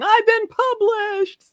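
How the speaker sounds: high, slow triumphant cry; Jo March's joy. Retold or imitated. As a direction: announce it like the best news of your life. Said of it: "I felt like Jo March ... saying".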